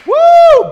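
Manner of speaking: very high, very loud whoop; excited whoop. Real, not acted. Preacher whooping at the congregation, ecstatic. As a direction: whoop with joy.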